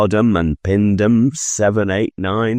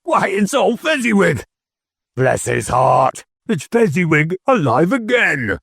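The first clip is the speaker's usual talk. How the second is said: put-on much higher, delighted old Scrooge crying out; great excitement; 12 semitones higher than usual. A narrator's reading. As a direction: burst with joy at an old friend. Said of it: "Scrooge cried in a great excitement".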